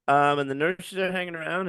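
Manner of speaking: ordinary talk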